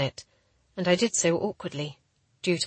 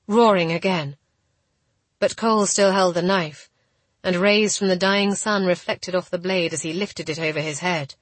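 usual speaking voice

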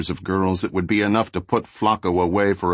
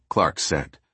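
plain reading voice

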